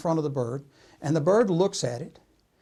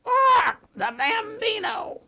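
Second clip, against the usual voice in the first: very high, drawn-out parrot squawk; squawk, then slowly screech out the nickname; squawking bird; 3 dB louder, slower than usual. Acted. Bird answering the store owner, squawky parrot voice. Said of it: "And the bird says".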